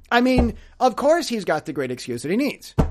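ordinary delivery

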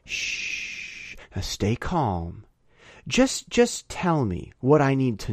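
whispered shh, then low and soft; soothing assassin. Retold or imitated. Assassin calming the wounded man, hushed and low. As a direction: coax the victim quietly, slow. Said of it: "He goes".

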